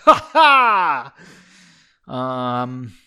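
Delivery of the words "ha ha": loud, high mock belly laugh; exaggerated, drawn-out fake "ha ha"; hammed-up laugh. A narrator's reading. Narrator voicing the older mage's laugh, loud and hammed-up. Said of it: "he laughed".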